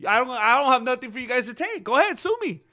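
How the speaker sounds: high, loud, taunting retort; mock defiance. Streamer acts out fighter daring promoters to sue, mock defiant. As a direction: crow it, daring them to try. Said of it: "He's gonna be like".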